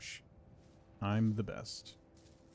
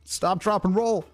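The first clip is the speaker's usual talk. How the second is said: higher, quick chant of the safety rule; urgent; 7 semitones higher than usual. Real, not acted. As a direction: recite it in a hurry. Trying to put out fire.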